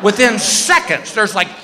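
usual speaking voice

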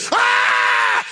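high mock scream; short acted-out scream of someone trapped; imitated scream. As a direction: imitate a panicked, high-pitched yell. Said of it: "The screaming".

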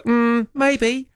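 high, drawn-out hesitant maybe. Shown as a demonstration. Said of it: "said it a bit softer".

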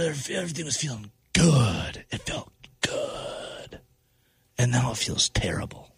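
low, sinking almost to a whisper; deflated. Real, not acted. Host mourning the loss that feels terrible, deflated near-whisper.

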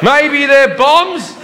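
high, sheepish yelp of confusion; mock clueless. Comedian answering the security guard, high mock-innocent. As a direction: play dumb, voice up high.

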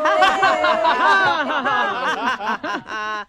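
long cheerful laugh; appreciation laughter. Shown as a demonstration. Teacher sends appreciation laughter to the team out there. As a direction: laugh out thanks to the listeners. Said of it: "just do appreciation laughter".

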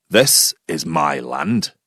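put-on very low, slow Scottish accent; stern Scottish landowner, drawn out and deep; Scottish farmer. Farmer warns off the unwelcome walker, stern Scottish accent. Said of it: "he looked even more puzzled".

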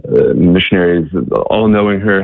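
ordinary delivery

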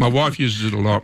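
talking with a mouth full. Real, not acted. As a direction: speak through a mouthful of food. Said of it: "I'm talking with my mouth full".

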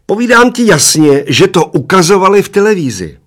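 put-on high, loud old man's shriek. A narrator's reading. Old man insisting to his mate about television, shrieking loudly. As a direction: shriek it out at full pitch. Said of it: "ječel strašně nahlas".

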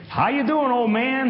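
put-on a friendly shout to an old teacher; Walter calling out. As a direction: call it out across the street, high and bright. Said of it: "he said".

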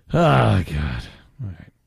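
ordinary talk

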